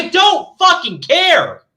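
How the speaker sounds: slow, emphatic angry declaration; exasperated slow rant. Real, not acted. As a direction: spit it out slowly, fed up.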